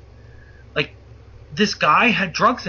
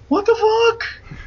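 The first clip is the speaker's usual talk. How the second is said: very high, drawn-out comic squeak of shock; stunned disbelief; slower than usual. Retold or imitated. Driver thinks it as guns come out, high stunned disbelief. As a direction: stretch it out in high-pitched mock bewilderment.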